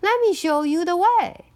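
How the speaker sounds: sly fox politely offering help. Acted. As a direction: lure them in with false kindness. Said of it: "said Foxy Woxy".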